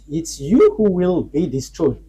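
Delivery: slow down and lean hard on "you"; emphatic warning. Real, not acted. Preacher warning America and nato, emphatic stress on "you". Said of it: "I emphasize that it's you".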